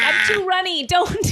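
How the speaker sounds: high, mock whiny egg plea; playful high-pitched whine; runny egg voice.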